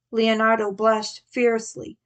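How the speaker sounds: usual speaking voice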